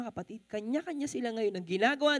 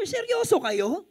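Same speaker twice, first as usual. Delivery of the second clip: higher, exasperated scolding as the waiter; a retold waiter telling the diners off, higher; mock indignant waiter; irate waiter; 7 semitones higher, 3 dB louder than usual. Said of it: "Yun ang sabi ng waiter".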